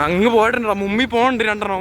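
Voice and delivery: shouting after friends riding ahead; calling out. Real, not acted. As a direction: call out loud and high.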